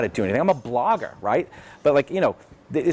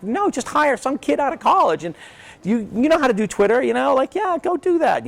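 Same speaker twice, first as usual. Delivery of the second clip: exasperated mock retort; 11 semitones higher than usual. Talking about the endless job search, an exasperated high retort.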